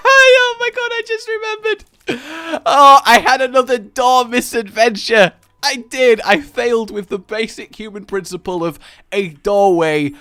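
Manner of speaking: high, loud burst of excitement; excited outburst. Real, not acted. Laughing to viewers about a door misadventure, loud and excited. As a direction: blurt it out, suddenly remembering. Sudden memory of door mishap.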